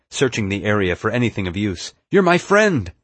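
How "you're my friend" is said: high, loud, earnest yell; yelling back. A narrator's reading. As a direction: yell it to convince a friend. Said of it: "I yelled back".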